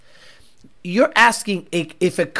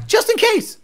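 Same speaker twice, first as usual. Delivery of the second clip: play-acted squeaky justification; high-pitched mock excuse; 13 semitones higher than usual. Imitated cop justifying a tackle, high-pitched. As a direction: jump up high, same volume.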